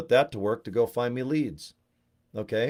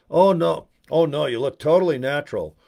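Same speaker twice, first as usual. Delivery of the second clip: a friend's fake reassurance; 5 semitones higher, 7 dB louder than usual. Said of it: "they look like this".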